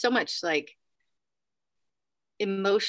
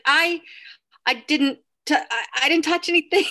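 high, louder stammered denial; flustered protest; 7 semitones higher, 5 dB louder than usual. Retold or imitated. Guest answering the front desk from elevator, flustered denial.

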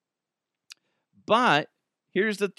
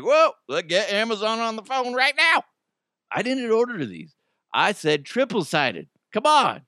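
high, indignant complaint; outraged excitement; 8 semitones higher than usual. Pretend. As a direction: demand it, worked up. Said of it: "I'd be like".

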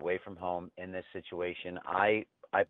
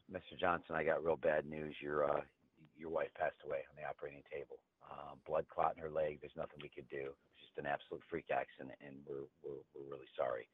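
softer, lower grave doctor voice; somber doctor; 4 semitones lower, 9 dB quieter than usual. Acted. Doctor telling the husband his wife died, softly somber. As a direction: break the bad news gently. Doctor gives bad news.

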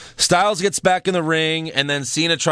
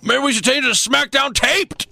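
high-pitched, hammed-up hype voice; mock announcer cry; 11 semitones higher than usual. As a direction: proclaim it in a strained falsetto.

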